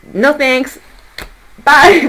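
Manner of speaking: high mock brush-off, loud on the bye; breezy refusal. Tarot reader voicing clients who decline, breezy refusal. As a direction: play someone bowing out, ending on a loud high bye. Voices people turning offer down.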